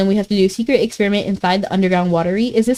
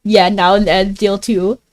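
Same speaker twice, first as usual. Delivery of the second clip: ordinary talk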